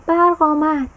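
put-on boy cheering as the power returns; happy shout. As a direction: shout it out, delighted. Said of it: "با خوشحالی فریاد زد".

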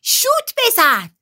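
high, drawn-out yell; cheering shout. A narrator's reading. Kate yells to her teammate during the match, high drawn-out shout. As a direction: shout it out urgently across the pitch. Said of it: "کیت داد زد".

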